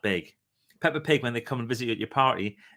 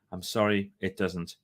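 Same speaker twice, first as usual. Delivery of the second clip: usual speaking voice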